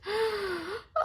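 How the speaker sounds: high gasp for air; gasp, spent; exhausted deer gasping; breathless gasp. Acted. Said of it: "Venado gasped".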